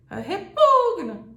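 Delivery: grito agudo, lento, com U esticado; chamado ao longe. Shown as a demonstration. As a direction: chame alguém lá longe, alongando o PUG. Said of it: "essa chamada lá longe". (gritando para quem está longe)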